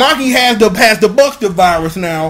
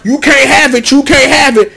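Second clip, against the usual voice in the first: louder, higher mock refusal; raised-voice squabbling, repeated; defiant taunt; 4 semitones higher, 5 dB louder than usual. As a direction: act out snatching it away, insistent. Acted tug-of-war over the driver.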